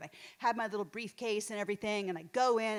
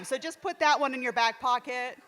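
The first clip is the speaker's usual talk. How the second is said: usual speaking voice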